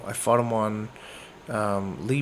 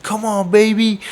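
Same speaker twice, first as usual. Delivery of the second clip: higher, louder imitation of an opponent's yell; taunting yell; 8 semitones higher, 6 dB louder than usual. Opponent yells at the hurt boxer mid-fight, mock taunting shout. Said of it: "he's yelling at me in the fight".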